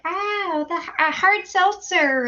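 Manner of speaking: high, gushy imitation of party guests; lightly hammed-up excited guest voice; mock girly guest. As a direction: ham up a squealy friend asking for seltzer. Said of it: "they're always like".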